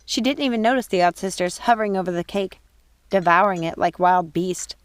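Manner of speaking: usual speaking voice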